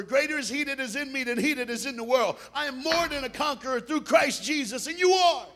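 loud, high, driving exhortation building to a shout; rally the congregation urgently; full-force preaching. Real, not acted.

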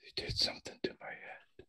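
hushed conspiratorial whisper. Acted. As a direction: whisper a secret to your son. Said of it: "Eugene whispering".

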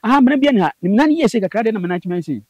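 a retold excited outburst; excited retelling. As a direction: tell it with rising urgency. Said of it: "me sɛ".

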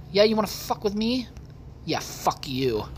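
ordinary talk, nothing marked

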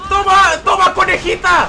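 loud, high-pitched taunt; fired-up gamer yelling; triumphant attack yell. Real, not acted. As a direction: shout as you land hits. Attacking an enemy in game.

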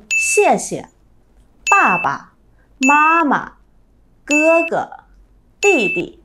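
first syllable stressed, second "xie", "ba", "ma" light and short; 轻声示范; neutral tone demo. Shown as a demonstration. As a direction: lean on the first syllable, drop the second away. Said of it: "Cô [name] lấy ví dụ nhá".

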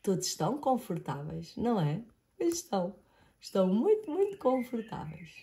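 soft whispered comfort; hushed whisper. Real, not acted. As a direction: whisper it cosily. Whispered cosy ending.

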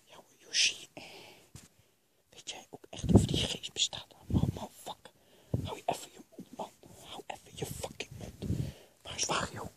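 hushed, irritated stage whisper; low-volume whispered exchange. Acted. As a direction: hiss it voicelessly at your companion. Whispered talk while hiding.

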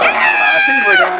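high yelp from the cold; shocked shout. Real, not acted. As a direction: gasp it out, freezing. Ice water just poured.

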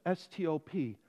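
letters spelled one by one, low and very soft. Retold or imitated. Spelling out the sign.

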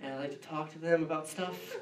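hushed, mumbled mock excuse, trailing into a laugh. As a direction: dodge the question with a sheepish murmur. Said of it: "I don't say".

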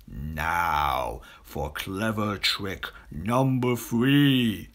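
slow, drawn-out crocodile voice, starting low; scheming crocodile. A narrator's reading. Crocodile plotting at the fair, slow scheming voice. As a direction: relish the cunning plan.

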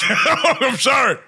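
laughing apology. Real, not acted. Player apologizing to friends after a crude joke, laughing. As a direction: crack up while saying sorry.

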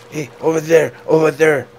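high, loud mock call; imitating the friend. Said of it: "his friend is just like".

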